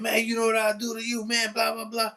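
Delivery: student repeating the threat, high and fast; threatening student. Retold or imitated. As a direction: rattle off the threat. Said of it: "He said".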